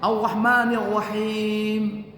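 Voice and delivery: chanted slowly with doubled R; slow recitation. Shown as a demonstration. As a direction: recite each word drawn out. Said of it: "middin ini ya, lidah-lidah daerah".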